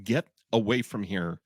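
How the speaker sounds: low, measured brush-off; dismissive. Retold or imitated. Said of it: "the tone of".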